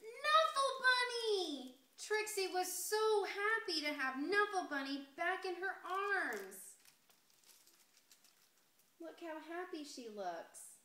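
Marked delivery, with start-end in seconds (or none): 0.0-1.7 high, slow, loud cry of joy; thrilled cry. A narrator's reading. Trixie spotting her lost bunny on the plane, overjoyed cry. Joyful rediscovery of lost toy. 2.0-6.5 delighted. A narrator's reading. Happy reunion with bunny.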